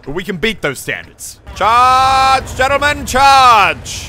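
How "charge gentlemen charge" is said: loud, high, slow charge call; rally the troops to charge; battle charge cry. Acted.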